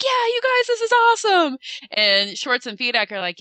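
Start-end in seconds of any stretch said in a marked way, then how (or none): 0.0-1.6 mock excited cry, much higher and louder; all excited. Zach hears of the third season renewal, imitated as all excited. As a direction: imitate giddy, high-pitched excitement. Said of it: "he's all excited".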